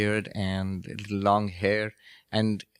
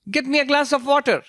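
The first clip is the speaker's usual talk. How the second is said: put-on high-pitched old teacher; 13 semitones higher, 4 dB louder than usual. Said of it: "He had a high-pitched voice".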